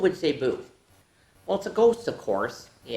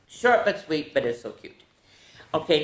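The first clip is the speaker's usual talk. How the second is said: ordinary delivery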